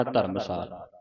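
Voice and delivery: ਧਰਮਸਾਲ read as a single word without a break; said as one word. Shown as a demonstration. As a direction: keep ਧਰਮਸਾਲ together in one breath. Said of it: "ਇਹ ਇਕੱਠਾ ਪੜ੍ਹਨਾ". (ਧਰਮਸਾਲ ਇਕੱਠਾ ਪੜ੍ਹੋ)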